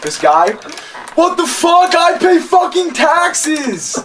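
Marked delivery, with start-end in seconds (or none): 1.2-4.0 much higher, slow shouted rant; imitating an angry driver yelling; mock furious yell. Angry man in next drive-thru lane.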